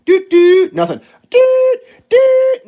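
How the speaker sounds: very high, loud doot horn imitation; honking harder at the oblivious driver. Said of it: "I honk my horn".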